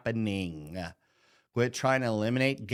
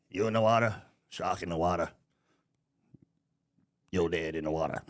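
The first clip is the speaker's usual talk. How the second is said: lightly voiced old sea captain, lower, dropping as it goes; a hint of the Jaws captain growling a warning, lower; mock sea captain; 8 semitones lower, faster than usual. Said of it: "like old fucking captain from Jaws said".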